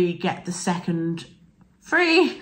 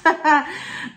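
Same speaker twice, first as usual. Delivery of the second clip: laugh; 8 semitones higher than usual. Real, not acted. Laughing at her own croaky voice.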